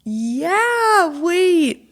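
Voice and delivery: high, wavering laughing "wait"; retelling the friend's flustered realization, high and half-laughing; surprised Katie. Retold or imitated. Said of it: "Katie goes".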